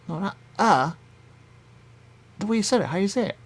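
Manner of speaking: high mock-voice correcting; mocking correction. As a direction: mimic someone nitpicking your "uh".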